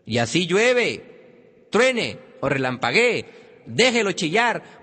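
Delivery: slow, punched-out phrases; drawn-out, forceful command; emphatic insistence. Real, not acted. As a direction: hammer each item home to parents. Emphatic advice to parents.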